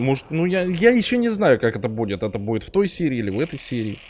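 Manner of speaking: usual speaking voice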